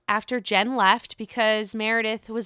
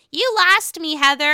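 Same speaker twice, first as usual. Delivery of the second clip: higher, louder mock retort; Whitney firing back; 6 semitones higher, 6 dB louder than usual. Whitney throwing the line back at Heather, a higher mock retort. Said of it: "And then Whitney was like".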